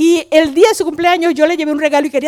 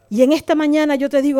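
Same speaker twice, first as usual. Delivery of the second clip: ordinary delivery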